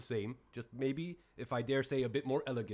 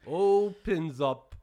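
slow and drawn out; 4 semitones higher, 7 dB louder, much slower than usual. Acted. Slow graphic detail.

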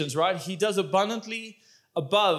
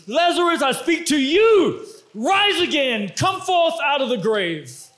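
commanding shout; 7 semitones higher, 4 dB louder than usual. Acted. Jesus calling Lazarus by name.

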